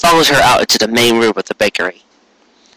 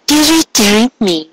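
very high, hammed-up theatrical sighing; high melodramatic dearie me; mock disappointment; 11 semitones higher than usual. A narrator's reading. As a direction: put on grand fake disappointment, way up high. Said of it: "affecting the airs of one wrapped in deep disappointment".

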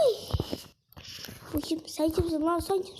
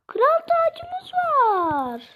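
excited; 11 semitones higher, 6 dB louder, much slower than usual. Pretend. Grand announcement.

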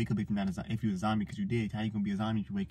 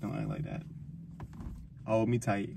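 usual speaking voice

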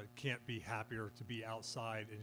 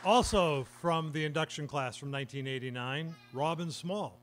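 announcer read; 2 semitones higher, 4 dB louder than usual. Real, not acted. Announces inductees by name.

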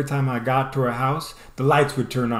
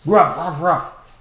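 playful barking, a little louder; imitating a dog bark; 3 semitones higher, 4 dB louder, slower than usual. Kid barking at a dog while running, imitating a bark. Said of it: "turned to bark to the dog".

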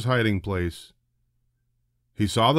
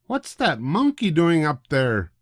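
higher voiced woman, demanding; 6 semitones higher than usual. A narrator's reading. Woman on platform demanding about the monkey. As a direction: demand an answer, indignant. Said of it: "demanded a woman".

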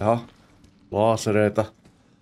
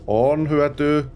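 second voice answering; 6 semitones higher, much slower than usual. Acted. Answers own question in other voice.

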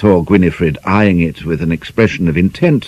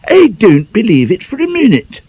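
shocked prim lady; 4 dB louder than usual. Acted. Gwynifred indignant.

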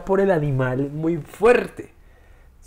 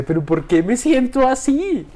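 mock anguish; 10 semitones higher, 5 dB louder than usual. Retelling grief over the dog.

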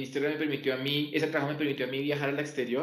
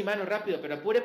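put-on quick, higher-pitched Santander accent from Bucaramanga; fast Santander accent; 6 semitones higher, much faster than usual. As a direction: rattle it off fast in a strong Santander accent, the way he used to teach. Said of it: "porque todo el tiempo estaba hablando así".

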